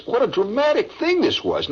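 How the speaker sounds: ordinary talk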